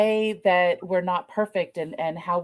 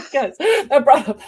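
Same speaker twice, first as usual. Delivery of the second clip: laughing; 7 semitones higher, 7 dB louder than usual. Real, not acted. Confessing her own parenting, laughing.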